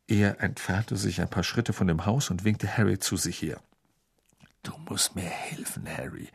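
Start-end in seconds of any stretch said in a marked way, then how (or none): 4.6-6.3 soft unvoiced whisper; murmured. A narrator's reading. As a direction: whisper it so the Muggle can't hear. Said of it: "murmelte er".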